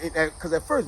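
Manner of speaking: plain reading voice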